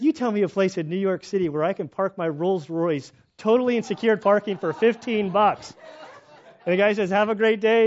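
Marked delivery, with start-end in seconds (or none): 0.0-5.7 smug retort. Retold or imitated. Rich guy's punchline, retold. 7.1-7.8 cheery loud goodbye. Retold or imitated. Rich guy leaves the bank.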